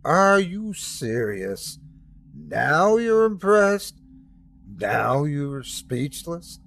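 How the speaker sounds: exclaiming. A narrator's reading. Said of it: "God suddenly exclaimed".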